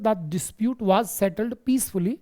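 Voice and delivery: teacher reading an example sentence slowly aloud. Shown as a demonstration. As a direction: read the English sentence very slowly, word by word. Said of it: "example आप देख सकते हैं".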